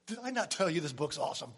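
ordinary talk

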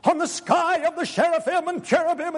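high, hammed-up archaic prayer voice; pompous King James prayer. Mock churchgoer praying in King James English, high and pompous. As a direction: pray like a stuffy old preacher. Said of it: "gotta use good King James words".

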